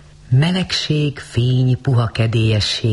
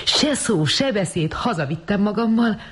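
much higher, gushing brag; imagined boasting; 11 semitones higher than usual. A narrator's reading. Rosemary imagines boasting to friends, gushing. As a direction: show off to friends.

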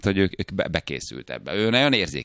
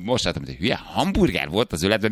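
ordinary delivery

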